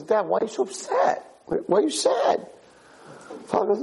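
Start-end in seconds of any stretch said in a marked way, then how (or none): 0.0-2.5 put-on high-pitched child's questioning; small, high puzzled son voice; concerned kid. Kid asking his upset father why, concerned.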